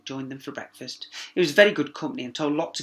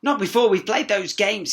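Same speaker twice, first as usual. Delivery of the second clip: higher, louder princess; 7 semitones higher, 9 dB louder than usual. A narrator's reading. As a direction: insist playfully. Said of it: "said the princess".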